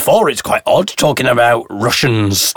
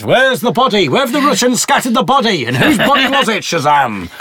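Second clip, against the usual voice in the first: higher-pitched mock interrogation, tickled; mock demanding questions; 7 semitones higher than usual. Podcast host quizzing Shazam about the Russians, mock demanding. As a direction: playfully quiz it in a raised, insistent pitch.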